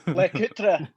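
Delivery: hammed-up Scottish pronunciation; best Scottish accent. Shown as a demonstration. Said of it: "in my best Scottish accent".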